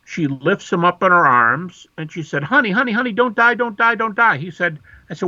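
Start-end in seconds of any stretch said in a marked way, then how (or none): 2.4-4.4 put-on high, desperate plea over a hurt child; imitating a frantic mother pleading. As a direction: beg him not to die, pitched up high. Mother over son she thinks dead.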